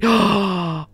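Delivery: big drawn-in gasp; awed gasp. Real, not acted. Gamer admiring the car's booty, gasping. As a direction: gasp at the sight.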